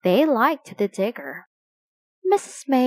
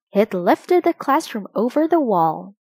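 usual speaking voice

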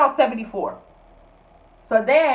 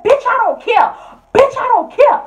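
much higher, louder shrieked retort; mock screaming roommate; 13 semitones higher, 9 dB louder than usual. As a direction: screech it like the roommate. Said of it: "She said".

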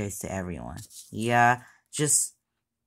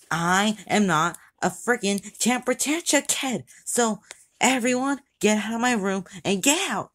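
higher, angry tantrum yell; temper tantrum; 8 semitones higher than usual. Acted. Kid throwing a tantrum at his friends, yelling angrily. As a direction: yell at everyone to get out of the room. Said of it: "stop with this temper tantrum".

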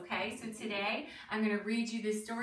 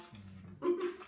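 small high giggle; quick little laugh; short amused chuckle; 9 semitones higher than usual. Real, not acted. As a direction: chuckle briefly at silly animals. Laughs at crazy animals.

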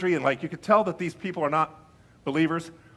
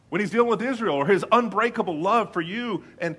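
ordinary talk, nothing marked

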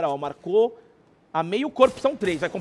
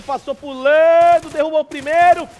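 excited play-by-play; 4 semitones higher, 7 dB louder than usual. Real, not acted. Live call of a knockdown.

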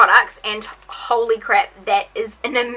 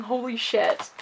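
ordinary delivery

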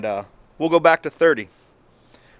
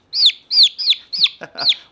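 high-pitched burst of laughter; 5 dB louder than usual. Real, not acted. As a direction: laugh out loud, high and quick. Laughs at the struggling turkey.

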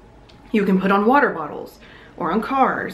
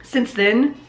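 normal talking voice

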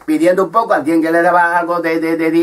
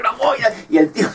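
ordinary delivery

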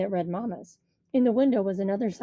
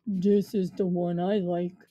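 slow big thug; 3 semitones lower, slower than usual. A narrator's reading. Cheese the big thug speaks.

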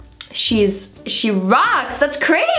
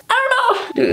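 squeaky unsure; 13 semitones higher than usual. Real, not acted. Girl admitting she has no idea how, squeaky high. Unsure about sectioning hair.

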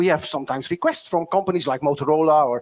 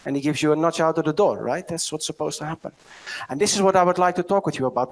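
ordinary talk

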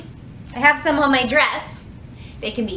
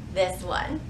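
plain reading voice

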